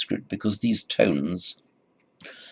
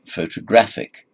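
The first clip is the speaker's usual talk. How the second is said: photographic stressed loudly on GRAPH; half stress on PHO, full stress on GRAPH in photographic; pho-to-GRAPH-ic, third syllable strong; 5 dB louder than usual. Shown as a demonstration. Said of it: "stress on the third syllable".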